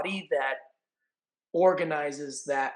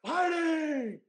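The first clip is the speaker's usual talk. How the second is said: booming fight-announcer call, much higher and louder; Bruce Buffer; 10 semitones higher, 4 dB louder than usual. Retold or imitated. Announcing the fighter to the arena in booming Bruce Buffer style. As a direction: announce the red corner like a ring announcer. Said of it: "my best Bruce Buffer impression".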